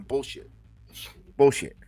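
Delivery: ordinary delivery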